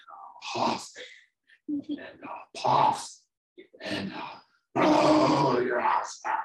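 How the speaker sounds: slow, breath-heavy huffing into a loud blow; threatening wolf. Acted. Wolf threatening two pigs, slow blustering build. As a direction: puff each key word hard and stretch blow.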